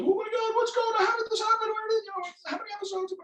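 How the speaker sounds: high, loud excited imitation of everyone; hammed-up gushing questions from friends; mock excited crowd. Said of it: "everyone was like".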